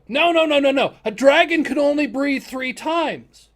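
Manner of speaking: high, loud no-no-no; loud protest. Real, not acted. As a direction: argue with the DM in disbelief. Players protest the DM.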